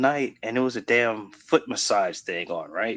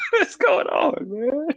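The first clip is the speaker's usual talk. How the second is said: high, slow, helpless laughter; cracking up; much slower than usual. Real, not acted. Friend retells waking up baffled by autoplay, cracking up. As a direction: laugh right through the words.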